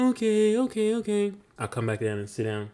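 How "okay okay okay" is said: son's reply. Retold or imitated. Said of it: "son's reply".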